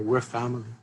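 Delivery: ordinary talk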